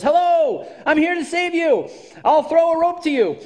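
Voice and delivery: loud, high hail; calling down from the ship. Retold or imitated. Captain calling down to a drowning person, loud and high. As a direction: shout down to the water, high and loud. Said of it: "the captain calls down and says".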